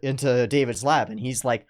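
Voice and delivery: usual speaking voice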